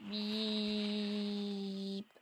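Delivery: flat bleep sound in place of a swear word; censor bleep. Shown as a demonstration. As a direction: say bip like a TV censor. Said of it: "Je fais bip au lieu de faire".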